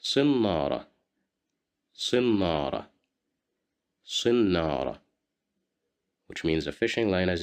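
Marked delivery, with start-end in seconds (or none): 0.0-5.0 صنارة repeated, emphatic s with i; model word. Shown as a demonstration. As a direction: say صنارة three times for learners. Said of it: "you will listen to me".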